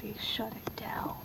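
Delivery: zacht gefluisterd klaagt; whisper. Real, not acted. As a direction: murmelt het verbitterd weg. Whispered complaint about game.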